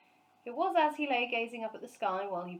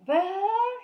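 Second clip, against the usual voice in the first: a huge furious bellow, much higher and louder; 12 semitones higher, 12 dB louder than usual. A narrator's reading. Neighbour spotting the bear in his tree, bellowing. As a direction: roar the name across the garden. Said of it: "he bellowed".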